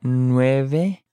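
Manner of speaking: "Nueve" in two long syllables; slow model. Shown as a demonstration. Teacher modelling vocabulary for learners, slow "Nueve".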